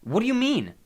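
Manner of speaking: high, loud questioning cry; insistent demand. A narrator's reading. Nico presses his sister's ghost, demanding loudly. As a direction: press hard for an answer.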